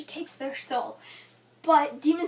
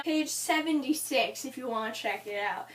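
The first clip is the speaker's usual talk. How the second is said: normal talking voice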